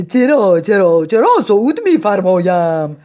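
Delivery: loud, eager royal we; pompous madman. Acted. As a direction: accept like a minister. Madman accepts grandly.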